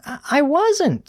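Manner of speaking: put-on high, halting girl pupil; protesting Susie. A narrator's reading. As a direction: stammer a high-pitched protest. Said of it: "started Susie before she was cut off".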